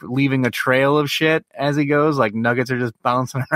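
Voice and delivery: ordinary talk, nothing marked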